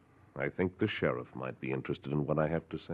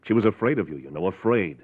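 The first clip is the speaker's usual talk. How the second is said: louder, leaning on was and afraid; pressing; 4 semitones higher, 6 dB louder than usual. Acted. Doctor pressing a husband about his wife, insistent. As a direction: drive the point home.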